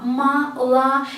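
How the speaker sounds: "ma, la" as separate open syllables; O pronounced as A. Shown as a demonstration. Teacher breaking down milk, "ma, la" with open A. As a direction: sound out "ma", then "la", with a clear A. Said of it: "pronounce the first two as A".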